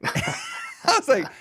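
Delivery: laughing high squeal. Real, not acted. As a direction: laugh through the words. Laughing disbelief at being ignored.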